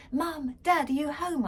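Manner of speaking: put-on girl bursting in calling her parents. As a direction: call through the house. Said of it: "I cried".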